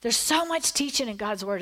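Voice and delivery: usual speaking voice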